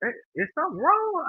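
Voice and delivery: high-pitched bewildered question. Retold or imitated. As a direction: act out puzzled alarm, pitch way up. Retells confusion on seeing the clock.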